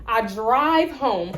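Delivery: normal talking voice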